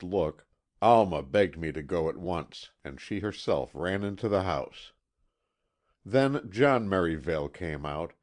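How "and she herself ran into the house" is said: plain reading voice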